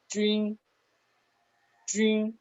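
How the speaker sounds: "trin" on a high, level, held tone; pronunciación modelo. Shown as a demonstration. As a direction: say "trin" high and flat, as a Chinese first tone. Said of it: "que se pronuncia". ("trin" agudo y sostenido, en tono plano)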